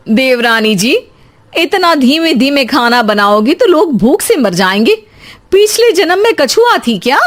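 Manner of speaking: acted-out snide taunt; taunting sister-in-law. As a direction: needle her with a rising question.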